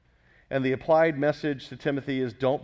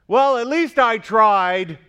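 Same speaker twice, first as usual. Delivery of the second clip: louder, higher, slow mocking whine of a quitter; mock excuse; 7 semitones higher, 10 dB louder, slower than usual. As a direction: parody the lazy excuse, drawn out. Mocking a half-hearted believer.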